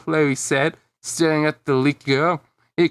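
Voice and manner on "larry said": ordinary delivery, nothing marked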